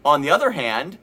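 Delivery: ordinary talk, nothing marked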